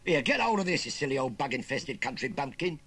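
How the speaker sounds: high, loud Cockney jeer; aggressive sneer. Acted. Nephew ordering his uncle to work, aggressive sneer. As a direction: shove the order at him, loud and scornful. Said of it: "sneered and thrust the muddy spade at him aggressively".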